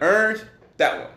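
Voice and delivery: high, "Orange" in his New Orleans way; saying orange. Retold or imitated. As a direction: show off how "Orange" comes out. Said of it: "I was like".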